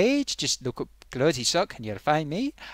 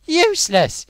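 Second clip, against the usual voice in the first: exasperated outburst; 13 semitones higher, 5 dB louder than usual. Real, not acted. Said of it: "You know the way they make us talk".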